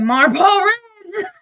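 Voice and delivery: high, loud, drawn-out burst into laughter; cracking up. Real, not acted. Reading a friend's message aloud, cracking up. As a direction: crack up as you stretch the words out.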